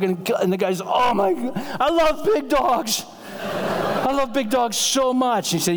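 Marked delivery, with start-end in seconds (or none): 0.8-5.5 high, drawn-out gushing delight; kooky excitement. Retold or imitated. Groggy son told he has a big dog, kooky excitement. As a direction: gush like a loopy patient, hammed up. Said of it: "they act kooky".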